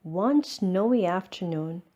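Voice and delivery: ordinary delivery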